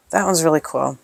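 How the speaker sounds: quiet whispered approval. Real, not acted. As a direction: hush it, pleased. Admiring a sticker quietly.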